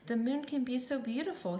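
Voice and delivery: ordinary delivery, nothing marked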